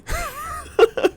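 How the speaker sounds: a sudden high-pitched giggle; high squeaky laugh. Real, not acted. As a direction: laugh up high at your own punchline. Laughing at own joke.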